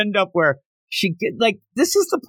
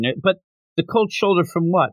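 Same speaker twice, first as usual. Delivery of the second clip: ordinary delivery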